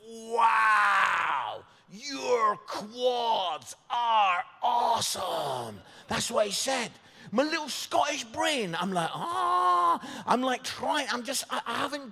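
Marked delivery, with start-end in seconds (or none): 0.1-5.8 drawn-out, loud, higher-pitched American gush; American's gushing amazement. Retold or imitated. American guy admiring comedian's legs in shorts, gushing loudly. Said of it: "he just went". 9.1-10.0 high, loud drawn-out "ah"; stunned cry. Acted. Scot overwhelmed by an American's praise, stunned high cry. His stunned inner reaction.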